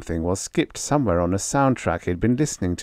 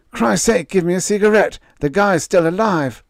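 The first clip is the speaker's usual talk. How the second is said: relieved crew voice; 11 semitones higher, 6 dB louder than usual. Acted.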